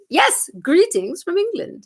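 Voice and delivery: high, slow mock-English greeting; terrible English accent. Host greets for a British viewer, terrible English accent. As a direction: put on a bad English accent. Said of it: "I have a terrible accent".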